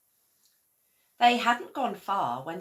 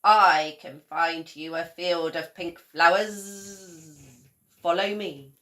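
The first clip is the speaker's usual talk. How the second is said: louder bee; 9 dB louder than usual. A narrator's reading. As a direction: lead them along like a bee. The bee keeps talking.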